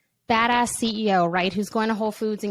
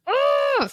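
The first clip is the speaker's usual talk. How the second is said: exasperated ugh. Retold or imitated. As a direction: groan like a fed-up parent. Frustrated parent sound.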